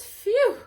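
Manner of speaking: high loud puff of relief; relieved phew. Acted. Peacock gets into the hen house, loud phew. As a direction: blow out hard after the squeeze.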